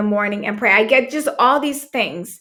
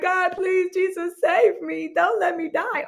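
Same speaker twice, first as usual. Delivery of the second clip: imitating crying prayer; 7 semitones higher than usual. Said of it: "I am crying like".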